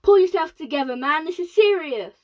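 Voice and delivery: stern snap. Acted. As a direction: snap him back into line. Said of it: "snapped ground control".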